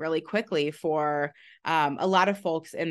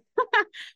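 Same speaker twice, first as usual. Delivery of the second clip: short laugh. Real, not acted. Laughing at the rest stop.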